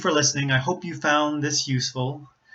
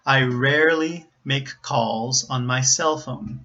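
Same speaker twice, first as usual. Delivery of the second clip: slow, careful model reading; practice sentence; slower than usual. Shown as a demonstration. Teacher gives a sentence to practice, slow model reading. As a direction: read it slowly for learners to copy. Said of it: "sentences that perhaps you could practice".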